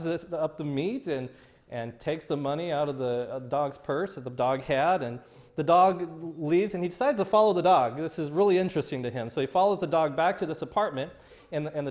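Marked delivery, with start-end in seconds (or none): none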